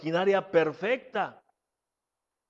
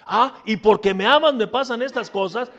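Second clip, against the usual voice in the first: mock indignant; 7 dB louder than usual.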